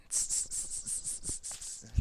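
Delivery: soft hissed tss sounds; monkey "tss" chatter. Acted. Children joining in as the monkeys, hissed tss-tss. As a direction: keep a steady run of monkey hisses. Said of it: "shook both their hands back".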